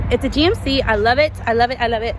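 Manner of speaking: loud, gushing delight; excited. Real, not acted. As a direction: rave about the car, thrilled. Thrilled by the GMC.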